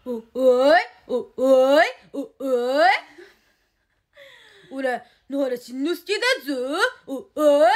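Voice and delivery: loud cartoon boy voice, stammering 'oi'; loud stammering cartoon-boy impression; 野原しんのすけ impression. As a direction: shout it like the cartoon kid.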